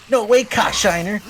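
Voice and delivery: high, loud heroic outburst; outraged Sonic. Acted. Sonic bursting in on Eggman, high and loud outrage. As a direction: yell it like a cartoon hero. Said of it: "He's like".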